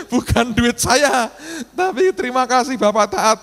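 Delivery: high-pitched put-on thanks; mock gratitude. Continues rueful thanks, money gone.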